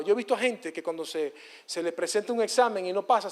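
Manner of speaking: ordinary talk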